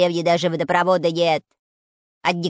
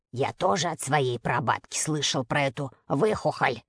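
ominous voice; 6 semitones lower, 5 dB quieter than usual. A narrator's reading. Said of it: "зловещим голосом".

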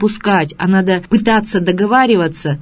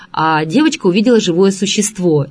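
ordinary talk

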